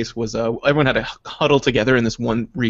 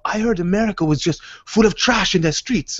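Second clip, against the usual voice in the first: badly faked Iraqi accent, pitched higher; attempted Iraqi accent; 7 semitones higher than usual. Iraqi soldier amazed by America, in a botched Iraqi accent. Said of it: "I can't do that accent".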